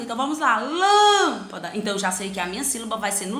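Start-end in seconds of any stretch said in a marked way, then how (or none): 0.6-1.7 LÂMPADA high and loud, with a long-held LÂM; stretched stressed syllable. Shown as a demonstration. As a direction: call out lâmpada, holding the lâm. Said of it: "Encontrar minha sílaba tônica". (lâmpada com o "lâm" agudo, forte e bem alongado)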